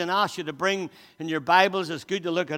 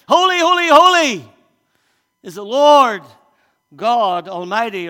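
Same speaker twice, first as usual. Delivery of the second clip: calling out; 5 semitones higher, 14 dB louder than usual. Acted. Preacher reads seraphim calling out.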